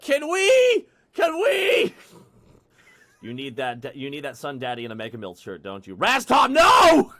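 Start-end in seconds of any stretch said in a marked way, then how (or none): 0.0-1.9 put-on high, loud, childlike pleading; excited begging. Excited pleading, repeated over and over. 5.9-7.1 high, very loud cry of no; big, high-pitched mock scolding; mock outraged shout.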